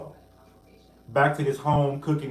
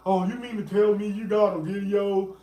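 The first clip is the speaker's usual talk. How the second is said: higher, put-on voice mocking the judge; fake-acting judge; 6 semitones higher than usual. Mocking the judge's fake reaction to a video, put-on and transparent. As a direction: act out the judge's transparently faked surprise. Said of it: "The judge was just up there faking, acting".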